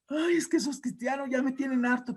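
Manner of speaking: high-pitched mock-weepy grumble; tearful Satan. Satan moans to his demons, high mock-tearful complaint. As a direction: play a fed-up devil moaning through pretend tears. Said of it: "estaba llorando a mares".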